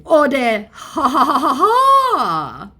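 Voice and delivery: high, slow, hammed-up mocking laugh; mock scammer laugh. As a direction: laugh at him like a gloating troll. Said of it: "he's obviously come back with".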